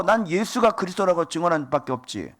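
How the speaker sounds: usual speaking voice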